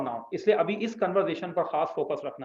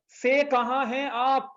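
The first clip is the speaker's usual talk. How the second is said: slow and higher, each word spaced out; slow demo; 7 semitones higher, much slower than usual. Shown as a demonstration. Teacher shows students the Arabic word order, slowly. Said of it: "हम देखें उर्दू में हम यू जा रहे हैं और अरब यू जा रहे हैं".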